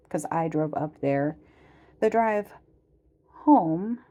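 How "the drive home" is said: higher, louder, drawn out; hint at a story to come; ominous teasing emphasis; dramatic tease. Shown as a demonstration. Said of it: "well, we'll get to that".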